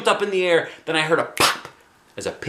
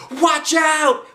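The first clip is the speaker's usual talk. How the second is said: very high, louder shout; shouted warning; 4 dB louder than usual. A narrator's reading. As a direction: yell a warning to classmates. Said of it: "Alexia shouted".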